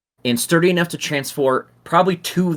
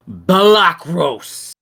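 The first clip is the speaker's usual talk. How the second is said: slow, drawn-out roar, much louder and higher; 6 semitones higher, 7 dB louder, much slower than usual. Acted. Lord Bell roars for his imp servant down the stairs, booming. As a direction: bellow the name across the forge. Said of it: "like, a roaring voice".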